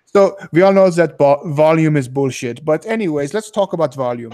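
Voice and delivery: put-on dismissive European accent, Swiss-style; mock Swiss accent. Swiss seminar attendee dismissing training volume, mock Swiss accent. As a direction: play along in hammed-up Swiss-accented English.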